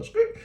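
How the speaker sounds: high, loud imitated skid; tire screech. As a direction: screech it like tires peeling out. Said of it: "You can even hear the tire go".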